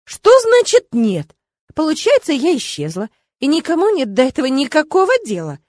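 high, loud offended protest; rising outrage at being forgotten; indignant outburst. A narrator's reading. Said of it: "Даже обидно стало".

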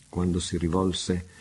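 ordinary delivery, nothing marked

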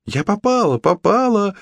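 very high, delighted squeal; proud exclamation. A narrator's reading. Red jumps proudly after nicking the stalk, exclaiming. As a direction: celebrate a tiny success in a high voice. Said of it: "воскликнула Шапочка".

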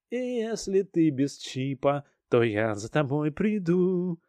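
slow, grimacing sing-song; mocking radio voice. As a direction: drawl it out, pulling faces. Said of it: "вязкий, кривляющийся голос".

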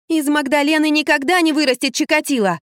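put-on high-pitched girl's objection; butting in. A narrator's reading. Girl defending her friend to the mother, butting in. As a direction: pipe up high and insistent. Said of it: "влезла Лизавета".

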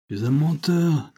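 high-pitched outraged accusation; acted outburst of rage; furious cry. A narrator's reading. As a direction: fling the accusation out in fury. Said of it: "pris de fureur ... s'écria-t-il".

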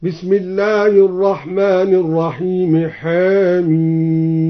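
slow recitation, حاميم begun as "hee-miim"; taqlil on Ha-Mim. Shown as a demonstration. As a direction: tilt the a of حاميم toward e, draw out the end. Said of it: "قلل الدوري الحاء من حاميم هكذا".

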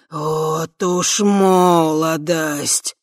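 slow booming dragon voice; thundering dragon. A narrator's reading. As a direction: rumble it out slowly like a dragon. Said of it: "громыхнул он". (громыхающий голос дракона)

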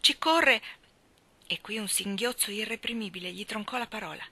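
ordinary delivery, nothing marked

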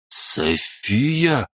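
high, stunned gasp of a name; disbelieving. Acted. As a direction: squeeze it out in disbelief. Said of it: "неверяще выдавил он". (неверяще)

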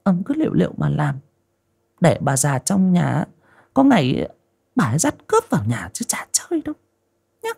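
high, sulky wife's voice sinking to a hiss; a young wife scolding her husband, sulky and high. A narrator's reading. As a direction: sulk and warn him, rising to a hushed hiss. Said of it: "Giọng của con Hoa giận dỗi".

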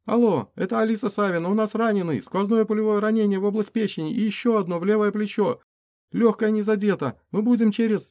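urgent high female voice; man down shout. Acted. Emergency phone call to hospital.

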